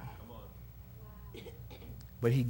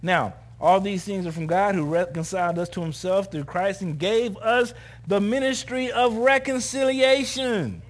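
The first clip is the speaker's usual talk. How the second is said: high, loud scripture proclamation; forceful preaching; 8 semitones higher, 7 dB louder than usual. Real, not acted. Preacher reading out the ministry of reconciliation, loud impassioned preaching. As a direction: proclaim it with rising force to a congregation.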